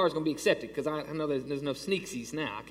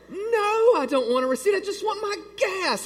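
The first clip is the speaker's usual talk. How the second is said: much higher, louder frustrated yell; exasperated shout; 6 dB louder than usual. Retold or imitated. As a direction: blow up at the stubborn gas pump. Yelling at the pump.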